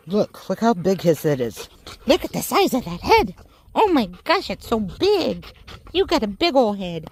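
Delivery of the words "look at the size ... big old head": higher, sing-song voice cooing at a pet. Real, not acted. Cooing over her dog.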